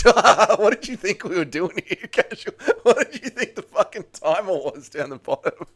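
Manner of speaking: high, laughing through the question; laughing incredulously. Real, not acted. Streamer teasing a forgetful viewer, laughing incredulously. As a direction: crack up while teasing them.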